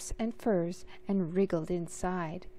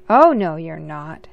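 bossy big sister; 3 semitones lower, 10 dB louder than usual. Acted. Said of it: "said Sophie".

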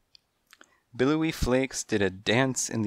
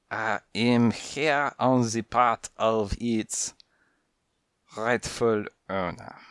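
put-on French accent. French cat voiced, slow.

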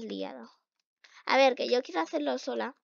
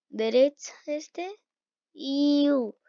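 normal talking voice

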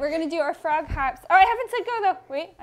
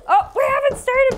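higher, louder halting call; 6 semitones higher, 6 dB louder than usual. Real, not acted. Teacher stops eager children early, calling out. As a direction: call them back to the start.

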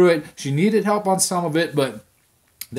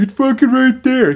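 frustrated yell at screen; 9 semitones higher, 8 dB louder than usual. Retold or imitated.

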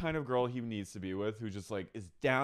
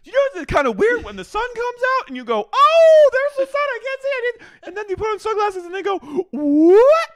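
squealy impression; 14 dB louder than usual. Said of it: "She goes".

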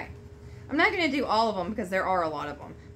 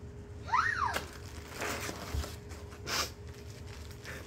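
gasp; 10 dB quieter than usual. Real, not acted. Overwhelmed on opening the box.

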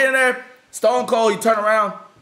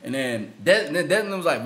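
ordinary talk, nothing marked

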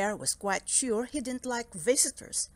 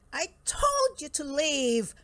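high, slow, exasperated scolding; stretched-out, annoyed bear voice; indignant cry; 5 semitones higher than usual. Acted. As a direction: cry out at an unwanted guest. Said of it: "cried Bear".

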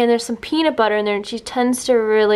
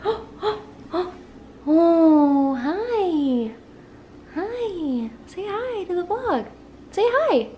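soft cooing; 5 semitones higher, 3 dB quieter, much slower than usual. Real, not acted. Owner softly cooing hello at her puppy. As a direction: coo softly up high.

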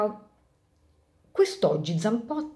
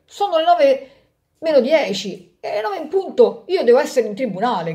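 loud, high urgent protest; shouting; 5 semitones higher, 6 dB louder than usual. Acted. Lawyer on the bus shouting he must reach court. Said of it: "gridò un avvocato".

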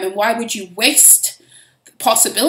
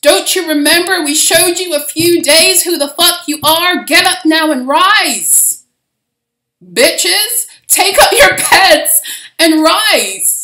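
loud, high rallying cry from on high; slow, booming call to rise; commanding shout; 7 semitones higher, 8 dB louder than usual. Retold or imitated. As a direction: roar at them to get up. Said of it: "they're like".

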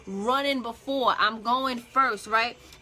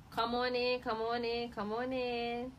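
each come on in softer; fading welcome; 7 dB quieter than usual. Real, not acted. Host welcoming late viewers, fading softer each time. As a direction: let the welcome die away quietly.

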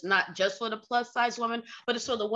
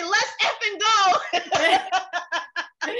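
higher, playful sailor bravado through a laugh; mock sailor swagger; 7 semitones higher, much slower than usual. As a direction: ham up a salty sailor about to cuss. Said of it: "you bring out the Navy sailor in me".

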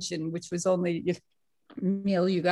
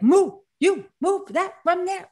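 loud, higher-pitched insistent order; intense command; 10 semitones higher, 5 dB louder than usual. Retold or imitated. Baba orders her to move the boulder, intense. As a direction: imitate an intense teacher giving orders. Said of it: "he got very intense".

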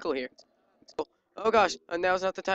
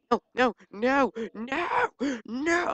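slow noes climbing much higher; rising, stretched-out panic; panicked noes; 7 semitones higher, much slower than usual. Real, not acted. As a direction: wail no, higher each time. Panicked protest mid-fight.

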